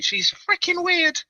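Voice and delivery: high squeaky mock tone. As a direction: squeak it out mockingly. Mocking rival.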